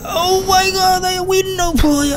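excited shout. Real, not acted. Shock at losing in game.

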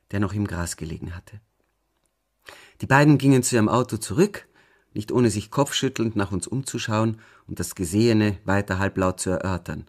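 ordinary delivery, nothing marked

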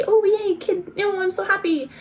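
high-pitched, hammed-up giddy happiness; mock giddy. Asking people if happy means this, mock giddy. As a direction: squeal it like someone playing overjoyed. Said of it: "like that giddy".

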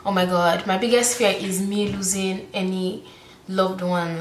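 ordinary talk

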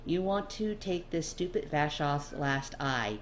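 plain reading voice

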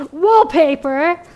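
loud, slow, thrilled shout; excited. Real, not acted. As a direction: cheer it out over the gift. Thrilled at a gift.

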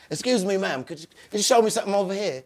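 high, loud, horrible fake London accent; hammed-up British accent to fool her. Teen to cashier, fake London accent, high and loud. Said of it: "I'm changing my accent".

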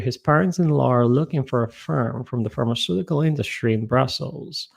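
English read in a careful American accent; teacher's American-accented demo. Shown as a demonstration. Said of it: "poprawnie przeczytane zdanie w akcencie amerykańskim".

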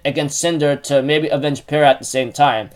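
usual speaking voice

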